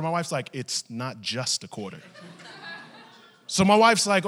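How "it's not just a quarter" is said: low, softer, measured rebuttal; firm correction. Retold or imitated. Wife correcting husband about the quarter, firm and low. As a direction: imitate a wife quietly insisting.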